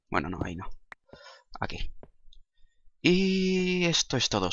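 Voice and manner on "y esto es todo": outro announcer. Acted.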